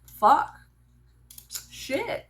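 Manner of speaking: startled swear, rising; dread. Retold or imitated. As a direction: act a kid realizing mortality. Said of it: "where they go".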